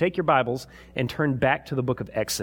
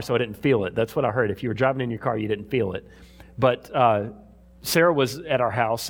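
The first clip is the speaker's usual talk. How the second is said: normal talking voice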